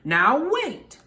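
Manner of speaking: put-on high, loud stern old witch; Stregonona stern. A narrator's reading. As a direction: halt the crowd firmly. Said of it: "said Stregonona".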